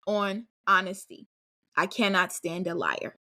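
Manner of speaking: ordinary delivery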